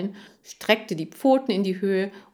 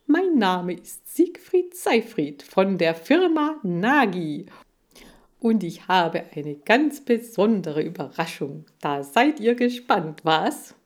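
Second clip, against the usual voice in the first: showy TV pitchman; 2 semitones higher, 5 dB louder than usual. Acted. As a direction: announce it like a boastful salesman. Voices TV pitchman Seifried.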